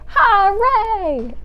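high, loud drawn-out cheer; big storytime cheer; shouted hooray. Acted. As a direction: shout it out in a falling whoop. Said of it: "shout hooray".

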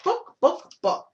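squawking hen, last Book jumps high; playful chicken voice; hen clucking title. Pretend.

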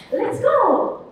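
high, loud cheer to start; rally the kids with a bright Let's go; excited shout. Shown as a demonstration. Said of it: "악보 탐험대 주제가를 한번 보세요".

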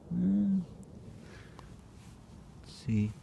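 usual speaking voice

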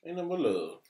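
high, sing-song teasing whine; a mock lovesick wail; high playful voice. Playful sung-out complaint.

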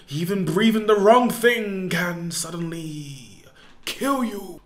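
normal talking voice

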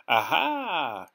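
mocking witch's aha; gloating witch; sneering witch. A narrator's reading. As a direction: sneer down at them. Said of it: "she sneered".

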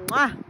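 smacking kiss sound for the camera; loud playful air kiss. Real, not acted. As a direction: blow a big loud kiss. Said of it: "blows a kiss to camera".

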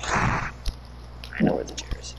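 soft, slow whisper. Real, not acted. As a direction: breathe it out in a whisper. Whispered repeat of line.

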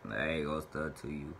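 ordinary talk

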